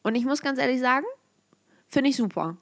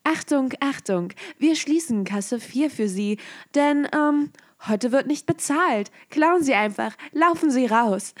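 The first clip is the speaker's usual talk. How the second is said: loud, higher PA announcement; Lautsprecherdurchsage; 4 semitones higher, 4 dB louder than usual. Pretend. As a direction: call it out over the store speakers. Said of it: "so eine Lautsprecherdurchsage machen". (laute Lautsprecherdurchsage über die Anlage)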